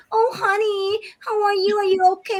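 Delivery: high, cooing mock-concerned mother; sweet American mom. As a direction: coo over the fallen child like a doting mom. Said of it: "sasabihin ng mga inay ng mga Amerikana".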